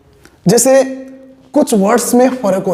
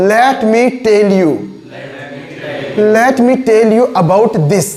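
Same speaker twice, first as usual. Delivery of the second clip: "Let me tell you about this" very slow, word by word; slow repeat-after-me; slow drill; much slower than usual. Shown as a demonstration. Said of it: "बोलो".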